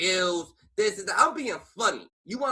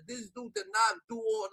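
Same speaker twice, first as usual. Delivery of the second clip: usual speaking voice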